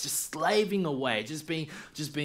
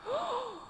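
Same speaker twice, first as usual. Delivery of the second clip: dramatic gasp; 6 dB quieter than usual. Acted.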